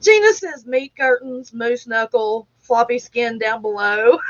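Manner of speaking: usual speaking voice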